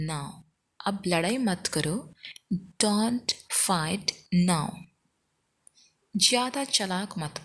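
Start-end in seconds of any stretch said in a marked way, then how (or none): 2.8-4.7 firm, word-by-word pace; very slow, spaced-out demonstration; slow model repeat. Shown as a demonstration. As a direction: separate each word clearly for learners. Teacher repeats slowly, word by word.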